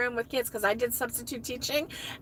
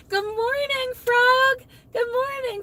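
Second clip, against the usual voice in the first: cheery greeting; 12 semitones higher, 4 dB louder, slower than usual. Real, not acted. Greeting viewers in sing-song.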